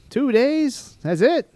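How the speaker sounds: high, loud incredulous mock Canadian; hammed-up surprise in a Canadian accent. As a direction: question it as a caricature Canadian officer. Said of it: "that Canadian accent".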